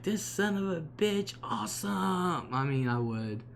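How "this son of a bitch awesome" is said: high, stretched-out cry of delight at a big catch; joyful swearing with a slow, drawn-out awesome; thrilled cussing. Pretend. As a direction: let it ring out, awestruck and happy. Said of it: "I'd be like".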